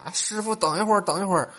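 high, loud urgent hail; mock frantic chasing-after call; calling after taxi. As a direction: shout for the driver to wait. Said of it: "就追着出租车喊".

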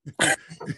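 high burst of laughter. Real, not acted. Teller laughing at his own trash talk, high laugh. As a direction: cackle at his own joke.

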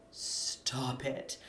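whispered stop it, very soft. Real, not acted. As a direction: hiss it under your breath. Hushed aside during rant.

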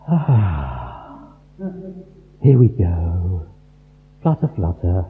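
mock heaven drifting open without fuss; droning low voice mocking a limp heaven; mock gentle heaven. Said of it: "heaven didn't go".

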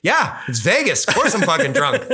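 high, animated retold retort; mock brash comeback. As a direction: imitate a shameless fighter firing back. Acts out brash promo comeback.

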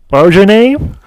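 loud, high, rising question; baffled Californian. Retold or imitated. Californians asking his name, loudly baffled. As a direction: imitate someone who cannot understand the name. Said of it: "struggling to understand my accent".

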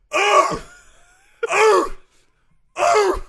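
very loud, high-pitched 'ugh'; bellowed tennis grunt. Acted. Coach playing a fearsome opponent serving, huge bellowed grunt. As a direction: bellow it up to the gods. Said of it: "let out a bellow that only the gods can hear".

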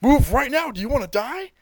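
sharp high yell, then dropping; yelling Goku. Retold or imitated. Goku yelling at Gohan and Krillin to flee, sharp shout. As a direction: yell at them to get moving. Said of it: "he's just yelling at them".

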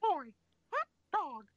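high-pitched cartoon squeak; Mickey Mouse. Showing off an impression to friends, high cartoon mouse. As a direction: squeak it out high, halting between words. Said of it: "there's the Mickey Mouse".

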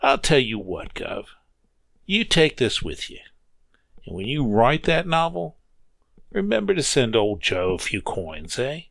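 put-on old Joe gently refusing to sell; sly old railroader. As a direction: play coy with a wink. Joe voiced, generous.

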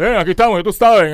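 hammed-up imitation of a lazy TV host; mocking TV loafer. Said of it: "esos zánganos que salen en televisión hablando". (imitación burlona de presentador vago, más fuerte)

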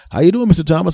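bright chipper doctor greeting the patient. Retold or imitated.

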